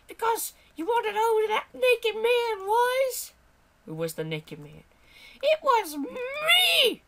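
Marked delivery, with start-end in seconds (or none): 0.1-3.3 very high, hysterical character; high-pitched mock outrage; worked-up sidekick voice. Character builds to a revelation. 5.4-7.0 very high, loud, drawn-out squeal; hammed-up high character shriek; shrieked confession.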